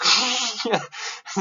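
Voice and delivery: high burst of laughter; big laugh. Real, not acted. Teacher laughing at his own rant. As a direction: laugh out loud at the absurdity.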